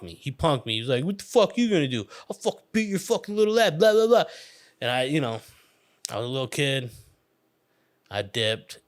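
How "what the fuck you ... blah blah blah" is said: higher, fast bully threat; tough bully voice. Retold or imitated. Bully Noah threatening the little kid, fast and high.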